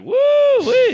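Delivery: high, loud joyful whoop; gleeful whoop. Retold or imitated. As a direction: whoop it out like Kramer. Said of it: "I'm like".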